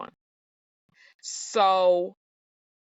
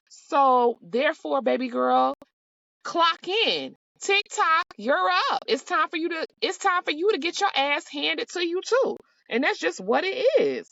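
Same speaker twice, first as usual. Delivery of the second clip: sassy call-out; 9 semitones higher than usual. Real, not acted. Calling Kyle out, sassy.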